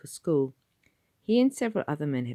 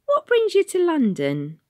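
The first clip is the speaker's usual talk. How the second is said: very high, kind Cockney conductress; gentle London bus lady asking; asked kindly; 10 dB louder than usual. Acted. Conductress to the boy Tom, high and kind.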